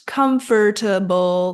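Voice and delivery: COMFORTABLE in four syllables, "KUM-fer-tuh-bul"; mispronounced COMFORTABLE. Shown as a demonstration. Teacher shows students the error, COMFORTABLE as com-fer-ta-bul. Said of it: "that's not quite right".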